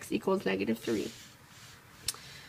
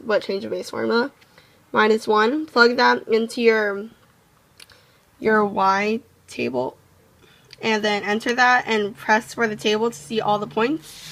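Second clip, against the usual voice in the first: ordinary talk, nothing marked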